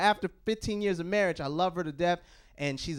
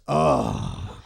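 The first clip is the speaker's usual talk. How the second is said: much lower, sultry oh; seductive oh. Pretend. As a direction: purr it low to the caller. Phone-sex bit to collector.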